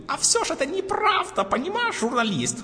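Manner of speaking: put-on high, thin old man's voice. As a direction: creak it out like an old pensioner. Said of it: "пытается изобразить голос старика".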